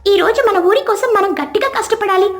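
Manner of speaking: rally your friend to hurry; eager youngster. Acted. Young helper urging his friend to gather sticks, eager.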